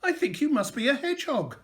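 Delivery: gently realising what he is; the kind lady. A narrator's reading. Lady speaking to the hedgehog, lightly voiced and gentle. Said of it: "said the lady".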